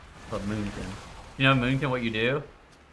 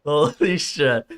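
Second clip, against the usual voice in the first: very slow, drawn-out disbelief; stunned; 6 dB louder, much slower than usual. Real, not acted. Streamer amazed after hanging up, stunned. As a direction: stretch each word in awe.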